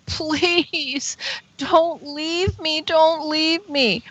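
slow, high begging; pleading. Retold or imitated. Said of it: "And so then I did it again".

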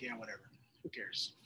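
soft, whispered who cares; mock Ammonites shrugging it off, dropping to a whisper; dismissive shrug. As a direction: brush it off under the breath. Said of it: "And the Ammonites are like".

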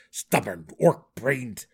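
loud, exasperated gnome insult; angry outburst, punched word by word; gnashed through teeth. A narrator's reading. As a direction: spit it out through gritted teeth. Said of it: "gnashed through his teeth".

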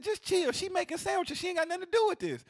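very high, insistent telling-off; worked-up storyteller quoting his own protest; retold exasperation. Retelling his argument with customer.

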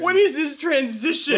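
high, loud incredulous outburst; comic disbelief. Real, not acted. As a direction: cut in loudly, voice leaping up in protest. Co-host mocks abrupt change of tone.

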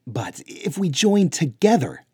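loud, urgent, cutting in; urgent interjection. A narrator's reading. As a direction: burst in before they finish. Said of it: "Luke interjected".

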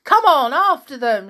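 high, loud rallying call; full-voiced boyish shout. A narrator's reading. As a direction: urge a friend to give chase. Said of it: "shouted Tom".

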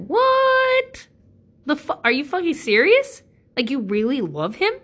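shrieking high what, then loud incredulous question; can't believe what she's hearing; disbelief. Retold or imitated. Shocked at Keith's kind words.

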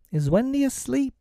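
put-on very high childlike question; asking boys. A narrator's reading. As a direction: ask in a small high child's voice. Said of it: "they asked".

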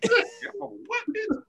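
very high, drawn-out cartoon squeal; squealed cartoon indignation; squeaky mock outrage. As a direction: stretch it out in a high imitation. Repeats the quoted cartoon line.

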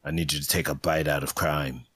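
low, hard-boiled narration; noir detective. Acted. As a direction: narrate like a film noir gumshoe.

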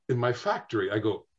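normal talking voice

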